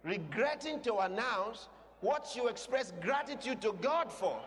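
high, challenging pulpit question; full-force preaching. Real, not acted. Preacher challenging those who announce deaths with regret, forceful preaching. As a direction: throw the question back at them forcefully.

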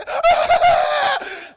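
loud, high burst of laughter; amused preacher laughs aloud. Real, not acted. Laughs at the crying mourners.

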